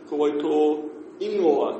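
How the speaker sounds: Ko wai tō ingoa said slowly and carefully; slow Māori phrase. Shown as a demonstration. Teacher repeating the question for learners. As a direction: model each word of the question. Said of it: "teacher modelling the phrase".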